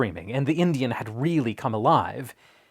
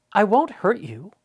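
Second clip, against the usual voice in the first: put-on higher, eager boy's voice; gently coaxing, young and earnest; 7 semitones higher, 3 dB louder than usual. A narrator's reading. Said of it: "he said".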